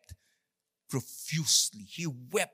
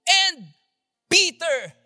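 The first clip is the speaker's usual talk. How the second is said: angel's message; 4 semitones higher, 7 dB louder, slower than usual. Retold or imitated. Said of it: "sabi niya dun sa mga babae".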